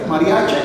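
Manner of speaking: "mariache" closed on a clear E; mariache with final E. Shown as a demonstration. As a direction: say "mariache" as written, with E. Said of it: "está con E".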